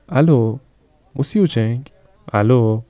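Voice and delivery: a shopkeeper calling into a silent phone; puzzled calling. Acted. Line goes dead mid-call. (الوهای متعجب و بلندتر)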